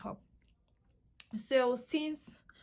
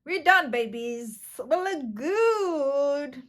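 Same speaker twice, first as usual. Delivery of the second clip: thrilled boast; 3 semitones higher, 9 dB louder, much slower than usual. Real, not acted. Showing off finished eye look.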